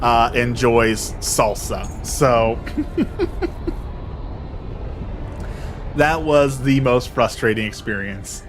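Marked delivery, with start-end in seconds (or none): 2.6-5.9 airy high-pitched giggle; high breathy laugh. Real, not acted. Vlogger laughing at the absurd customer, airy wheeze. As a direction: let out a silent wheezing laugh.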